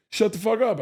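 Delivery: high-pitched imitated disbelief; mock disbelief. Hospital guy hears about the Red Rocks show, mock disbelief. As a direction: react as the incredulous guy, high voice.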